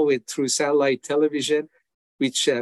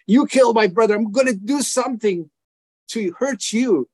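vengeful outburst; 7 semitones higher than usual. Retold or imitated. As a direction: spit out the vow of revenge. Voicing his own urge for revenge.

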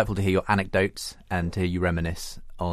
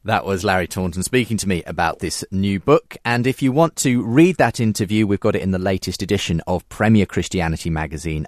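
louder, projected programme sign-off; radio presenter's outro; 5 dB louder than usual. Real, not acted. Presenter closing this week's show for listeners, projected announcer delivery. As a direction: announce the links and magazine to listeners.

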